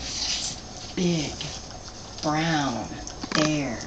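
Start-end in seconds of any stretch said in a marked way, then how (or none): none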